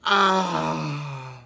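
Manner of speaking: ordinary delivery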